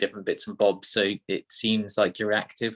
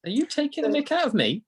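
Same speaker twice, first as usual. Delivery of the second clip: surprised. Real, not acted. Mock offended at accent imitation.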